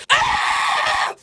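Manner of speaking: sharp high breathy scream of rage; angry scream. Real, not acted. Venting to Big Brother about Jerry, furious scream. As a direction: release a furious shriek. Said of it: "I'm so angry".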